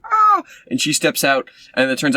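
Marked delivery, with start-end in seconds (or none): 0.1-0.4 a very high, loud cartoon shriek; mock shriek. Mocking the villain shrieking as the heroes close in. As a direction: squeal it like a spooked cartoon. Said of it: "Julia Louis-Dreyfus is like".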